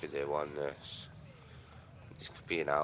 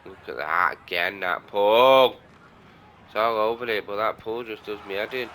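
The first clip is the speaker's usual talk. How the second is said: frustrated outburst; 4 semitones higher, 8 dB louder than usual. Real, not acted. Gamer annoyed at his pulled shot.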